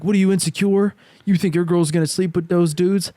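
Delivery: taunting friend, high and mocking. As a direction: needle him in a sneering voice. Friend taunting him, retold.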